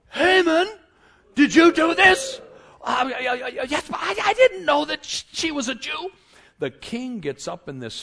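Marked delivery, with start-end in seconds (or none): 0.1-2.4 loud, high accusing shout; furious confrontation. Acted. King confronting Haman at dinner, in a furious shout. 2.8-6.1 high, stuttering mock panic; panicked stammer. Haman caught out by the king, stammering in panic.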